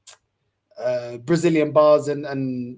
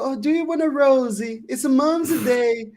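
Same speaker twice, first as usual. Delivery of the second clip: put-on Portuguese mum accent; 10 semitones higher than usual. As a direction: imitate a Portuguese mum's accent, pitched up. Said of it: "Do the Edu accent".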